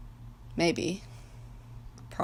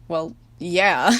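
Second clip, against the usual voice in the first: awkward; 9 dB louder than usual. A narrator's reading. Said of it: "he said awkwardly".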